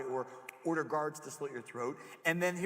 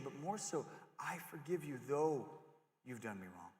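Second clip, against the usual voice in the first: gentle forgiveness; 3 semitones higher than usual. Acted. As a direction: hush down and forgive them. Joseph forgives his brothers.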